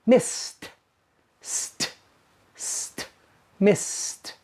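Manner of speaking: missed with a hissed st; missed, st breathed without voice; st ending. Shown as a demonstration. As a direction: whisper the st cluster. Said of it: "so you pronounce it".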